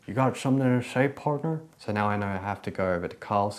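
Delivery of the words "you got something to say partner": cowboy voice. Retold or imitated. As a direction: quote the character as a cowboy. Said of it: "my character's like".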